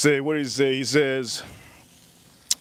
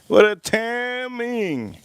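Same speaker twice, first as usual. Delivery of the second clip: high and very slow, savouring each word; drawn-out amazement; 7 semitones higher, much slower than usual. Shown as a demonstration. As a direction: stretch it out in mock disbelief.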